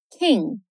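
King in American English, no extra vowel; English King, barely voiced G; closed final G. Shown as a demonstration. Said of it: "fecha ali o som do G".

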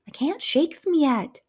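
high, slow, strained snap; snapped. Acted. Narrator snapping at Serga during the chase. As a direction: bite back under pressure. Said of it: "I snapped".

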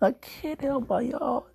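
high squeal through laughter; helpless laughter pushing the voice way up; cracking up. Real, not acted. Cracking up over own yawning.

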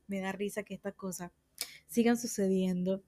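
ordinary delivery

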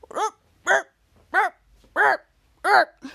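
string of short, high-pitched grunts; effortful grunts. Retold or imitated. Ray lifting a dumbbell, repeated mock grunts. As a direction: mimic straining under a dumbbell. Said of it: "and Ray's grunting".

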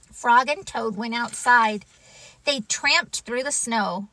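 ordinary delivery, nothing marked

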